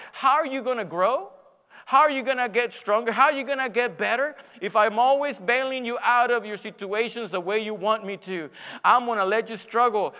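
loud, insistent rhetorical questioning; impassioned preaching. Acted. Preacher voicing God to the struggling believer, forceful preaching. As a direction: press each question on the congregation.

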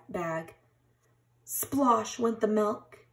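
plain reading voice

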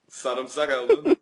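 slow words through laughter; laughing. Real, not acted. Laughs over garlic plan.

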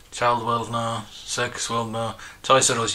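usual speaking voice

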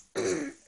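clearing a croaky throat. Real, not acted. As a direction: clear your throat roughly. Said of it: "I've lost my voice".

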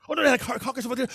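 panicked friend spotting a car crash; sudden excited character. Acted. Excited friend points out a car crash to his buddy, fast and high. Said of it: "The other's all sudden".

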